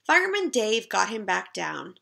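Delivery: ordinary delivery, nothing marked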